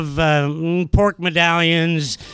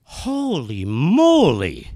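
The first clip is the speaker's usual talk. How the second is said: very slow, lower-pitched awe; slow dawning realization; 4 semitones lower, much slower than usual. Retold or imitated. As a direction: stretch each word as it sinks in. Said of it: "very slowly, it starts to come to me".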